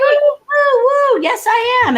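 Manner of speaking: high, loud whooping cheer; excited cheer. Pretend. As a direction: whoop like a thrilled prospect saying yes. Cheering as the eager prospect.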